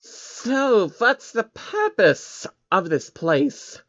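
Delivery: put-on high, slow childlike creature voice; little alien asking about the building; curious changeling. Changeling asks the men.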